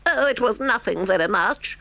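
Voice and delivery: high-pitched, sheepish excuse; squirming little toad playing it down; high voice, caught out; embarrassed Toad. A narrator's reading. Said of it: "said poor Toad, colouring deeply".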